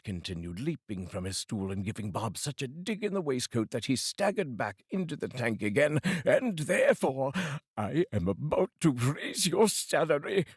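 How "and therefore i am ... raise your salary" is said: higher, bursting with delight; spring the good news; joyful reveal. Acted. Scrooge revealing Bob's raise with sudden joy.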